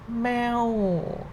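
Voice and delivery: "meow" high, then sliding down; falling tone. Shown as a demonstration. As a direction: start "meow" high and let it sink slowly. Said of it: "falling sound".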